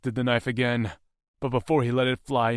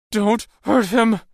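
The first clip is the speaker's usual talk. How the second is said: much higher, louder, slow cry; girl's shout; 11 semitones higher, 10 dB louder than usual. Acted. Maisie struggling against her captors, loud shout. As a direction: shout to protect him. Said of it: "Maisie shouted".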